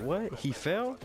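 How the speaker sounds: high startled disbelief; shocked dad asking, voice jumping up; surprised. Retold or imitated. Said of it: "my dad was surprised".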